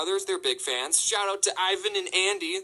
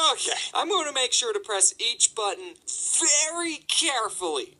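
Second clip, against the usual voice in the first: higher, exaggeratedly deliberate self-instruction; strained resolve; 7 semitones higher than usual. Employee retrying the alarm code, strained and emphatic. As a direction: stress each word with mounting exasperation.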